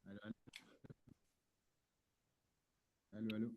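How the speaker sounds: distant, faint voice off mic; calling from far from the microphone. Real, not acted. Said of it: "Euh, on t'entend de loin".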